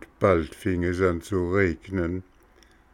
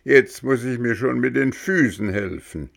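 a touch of anger, lightly raised; grumbling in half-hearted anger at the door; angry puppet; 5 semitones higher, 4 dB louder than usual. Acted. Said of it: "immer noch von Zorn erfasst". (Hampelmann, leicht gespielter Zorn)

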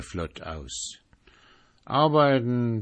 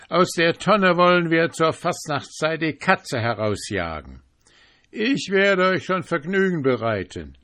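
merry jester; 3 semitones higher, 5 dB louder than usual. Acted. Prince Carnival boasting to the sentry, merry and loud. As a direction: play the carefree merrymaker.

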